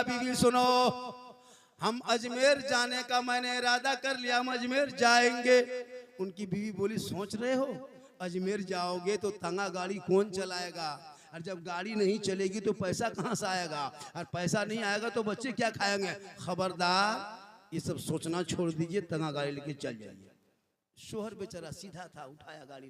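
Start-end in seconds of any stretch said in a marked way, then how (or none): 0.0-5.6 raised loud voice, firm; loud announcement. Retold or imitated. Husband declaring his Ajmer trip to his wife, loud. 7.0-20.3 stern scolding, loud; scolding wife. Retold or imitated. Wife scolding husband about the tonga, stern and loud.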